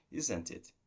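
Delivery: "Isn't it?" stepping down to the end; falling intonation. Shown as a demonstration. Teacher models checking the information for learners, falling intonation. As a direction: check agreement with a downward "Isn't it?". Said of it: "by using a falling intonation, say".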